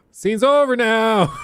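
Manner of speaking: high, loud, stretched-out call; escalate, trying to halt an actor who won't stop; desperate insistence. Retold or imitated. Crew insisting more desperately.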